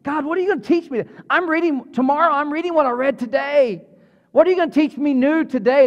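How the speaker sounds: high and eager, rushing ahead; excited prayer. Retold or imitated. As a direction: ask God like a kid on a holiday. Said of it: "It's exciting".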